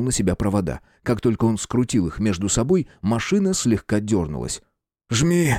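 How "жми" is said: roar. Acted. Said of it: "взревел я".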